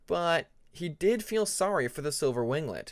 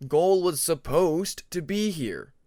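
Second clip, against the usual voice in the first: voiced Beaver; 3 semitones higher, 5 dB louder, slower than usual. Beaver explains missing partner.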